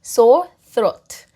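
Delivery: throat with the tongue between the teeth; th sound demo. Shown as a demonstration. Teacher shows the th sound in throat. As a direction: bite the tongue lightly for the th in throat. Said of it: "혀를 무셔야 됩니다".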